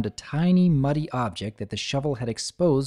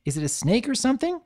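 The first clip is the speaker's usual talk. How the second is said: startled; 12 semitones higher, faster than usual. A narrator's reading. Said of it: "Scootaloo jumped".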